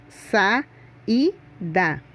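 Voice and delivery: "Sa" and "da" said as separate beats; syllable by syllable, sa, i, da; split syllables. Shown as a demonstration. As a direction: break the word into its syllables. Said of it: "ficam em sílabas separadas".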